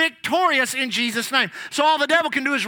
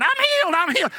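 hollering; 6 semitones higher, 3 dB louder, faster than usual. Retold or imitated. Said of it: "she starts hollering".

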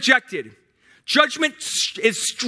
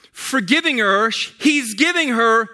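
ordinary delivery

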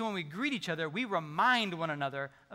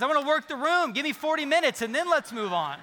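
eager extrovert; 6 semitones higher, 6 dB louder than usual. Pretend. Extrovert voiced craving more time.

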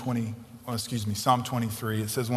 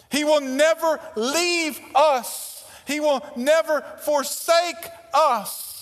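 higher, louder preacher's call, slowing at the end; full-force preaching; 5 dB louder than usual. Acted. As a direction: preach it hard to the congregation. Preaching with rising intensity.

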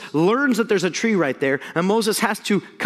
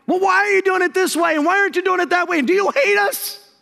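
much higher mock whine, rushing in the middle; whining; 12 semitones higher, 4 dB louder than usual. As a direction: whine like a petulant child. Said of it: "They whine".

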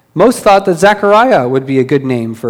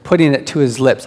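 usual speaking voice

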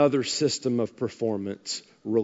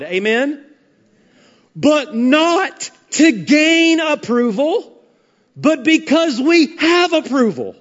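higher, slow, emphatic sermon delivery; preaching; 6 semitones higher, 4 dB louder, slower than usual. Acted. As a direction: lay each contrast out slowly from the pulpit. Preaches on obedience and approval.

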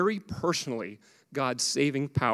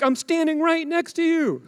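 much higher, mock-offended dad whine; hammed-up frustrated father; exasperated dad; 12 semitones higher, 3 dB louder than usual. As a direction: plead with the toddler as a put-out dad. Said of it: "How many dads in here can relate".

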